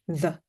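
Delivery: "the" said slowly with a voiced th, tongue between the teeth; voiced th demo in "the". Shown as a demonstration. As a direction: buzz the th in "the" with the voice on. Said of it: "listen and repeat the".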